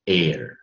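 air, said the British way. Shown as a demonstration. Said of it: "英式音标就念什么".

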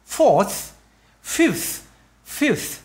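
"fourth, fifth, fifth" each ending in a released puff of air. Shown as a demonstration. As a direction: blow out the TH at the end of each word. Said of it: "bung ra cái âm hơi này". (bật hơi rõ âm TH cuối từ)

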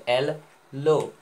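एल लो as two separate beats; split in two. Shown as a demonstration. Teacher showing two syllables, एल and लो said apart. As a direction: break it after एल, then a distinct लो. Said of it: "दो ठो word हो गए".

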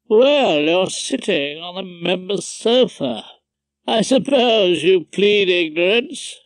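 Justice Vosper. A narrator's reading. Judge ticking off Rumpole over the sofa, officious. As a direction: pull rank with drawn-out relish. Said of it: "Mister Justice Vosper always had to have his two pennyworth".

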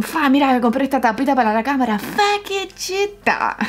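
a friend showing off a new gadget, lightly mimicked; imitating a friend. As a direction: mimic a pal bragging, then coo the last words. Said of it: "Y te dicen".

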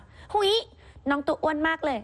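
high, drawn-out gushing coo; imitated commenter's voice. Anchor voicing a viewer's comment on the crocodile, high gushing voice. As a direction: coo it high and stretch the words out. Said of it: "เขาทำเสียงอย่างนี้เหรอ".

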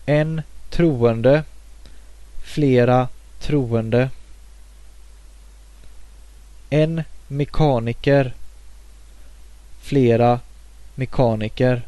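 careful Swedish: troende, mekaniker, unchanged in plural; teacher modelling Swedish occupation nouns; Swedish demo words. Shown as a demonstration. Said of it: "and some occupations can be here".

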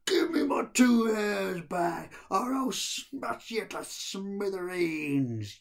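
ghoul's threat. Acted. Gadar the Ghoul menacing Ahmed, slow loud growl. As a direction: drag out the threat like a monster.

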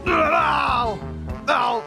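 high cries of pain; pained yelps. Acted. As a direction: yelp high with each hit. Hurt in a fall.

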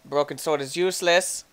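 ordinary talk

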